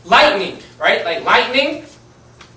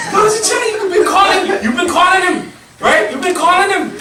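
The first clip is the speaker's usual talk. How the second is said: very high, louder, laughing scold; laughing disbelief; 12 semitones higher, 5 dB louder than usual. Retold or imitated. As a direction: cackle while you call him out. Friends mocking, laughing.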